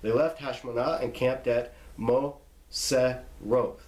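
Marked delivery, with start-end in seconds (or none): 2.0-3.8 "Moseroth" split into mo, seh, roth; syllable by syllable. Shown as a demonstration. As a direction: slowly sound out "Moseroth" with gaps. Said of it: "Pronounce it there".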